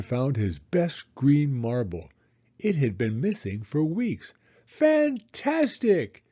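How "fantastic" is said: high, slow, drawn-out cheer; delighted storybook exclamation; excited shout. A narrator's reading. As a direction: shout it out high, stretching the word. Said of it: "he shouted".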